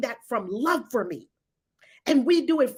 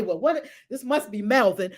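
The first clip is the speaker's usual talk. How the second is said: normal talking voice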